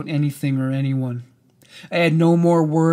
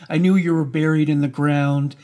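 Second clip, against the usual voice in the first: usual speaking voice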